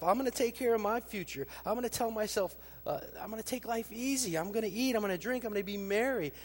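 a man pleased with his own plans; smug self-talk. Acted. Rich man's self-talk to himself, high and cocky. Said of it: "look at the self-talk".

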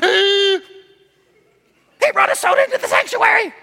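high, prissy gasp of outrage; mock scandalized churchgoer. Pastor mocking members shocked by a soda, high scandalized voice. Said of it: "it's like".

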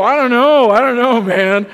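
high, loud retold protest; loud, high-voiced helpless answer; worked-up reply. Retelling anxious exchange after sermon.

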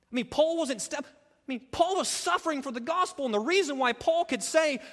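rising fervent pulpit delivery; impassioned preaching. Acted. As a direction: preach it with full force. Preaching at full force.

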